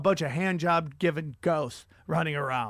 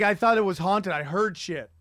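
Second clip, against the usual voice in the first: ordinary talk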